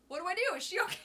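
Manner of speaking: high, loud mock panic with a laugh; frantic, half laughing; panicked. Panicking as friend stays underwater.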